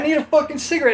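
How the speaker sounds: frantic, high-pitched demand. Retold or imitated. Drunk demand, retold.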